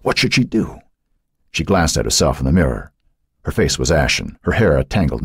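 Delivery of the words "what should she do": high, anxious question; panicked. A narrator's reading. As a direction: wonder it in mild panic. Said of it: "A wave of panic crashed over her".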